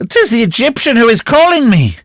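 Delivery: loud, very high frenzied scream; wild cry. A narrator's reading. Recluse at the window hearing that name, wild shriek. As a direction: scream it wildly at the top of the voice. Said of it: "she cried with an appalling laugh".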